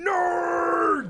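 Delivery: shouted nerd insult; Ogre shout. Retold or imitated. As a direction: yell it like a jock. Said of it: "Is Ogre gonna show up and go".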